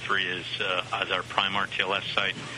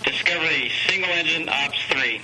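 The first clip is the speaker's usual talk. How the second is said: raised, higher voice for the crew; loud radio call; 6 semitones higher, 5 dB louder than usual. Real, not acted. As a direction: project it over the radio link. Calling the shuttle crew by radio.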